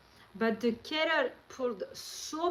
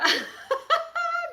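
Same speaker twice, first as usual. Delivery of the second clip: high burst of laughter; 7 dB louder than usual. Real, not acted. Laughs after teasing her friend.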